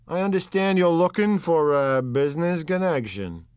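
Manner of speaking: un boss di strada che racconta, alzando la voce; Wolfsheim. Acted. Wolfsheim probes.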